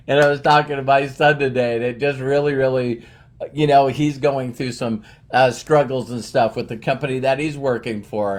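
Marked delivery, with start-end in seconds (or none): none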